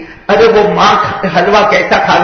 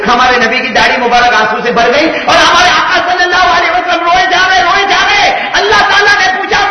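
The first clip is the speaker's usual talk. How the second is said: high, loud impassioned preaching; preaching at full force; 10 semitones higher, 5 dB louder than usual. Acted. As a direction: build to a fervent sermon peak. Preacher's rising climax. (پرجوش خطابت، بلند آواز)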